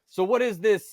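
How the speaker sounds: teasing a Norwegian teammate in an exaggerated accent; heavy Norwegian accent. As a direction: imitate a grumpy Norwegian player's accent. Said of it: "with the heavy, you know, s-, Norwegian accent".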